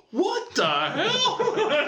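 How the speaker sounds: high, very slow mock astonishment; Dad's mock surprise. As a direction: play up Dad's fake shock. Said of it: "He'd be like".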